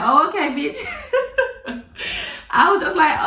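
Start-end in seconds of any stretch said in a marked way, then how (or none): 0.0-0.8 amused retort. Retold or imitated. Friend tells plan, amused reply. 0.8-2.5 a high-pitched burst of laughter. Real, not acted. Laughing at her friend's hammer plan, a high laugh.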